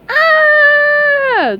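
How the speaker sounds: high shocked scream; startled cry. Acted. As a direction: shriek in alarm. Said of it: "cried in unison".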